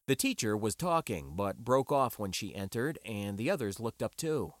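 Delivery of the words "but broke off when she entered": plain reading voice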